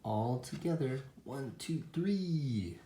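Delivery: storybook group call, drawn out on the last number; rallying count. A narrator's reading. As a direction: count everyone in for a big heave. Animals' counted push in the story.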